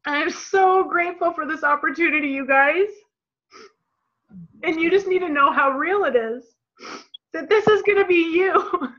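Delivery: real emotion welling up as she thanks the team; a voice on the edge of tears; choked up with gratitude; getting teary. Real, not acted. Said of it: "And I'm gonna get teary again".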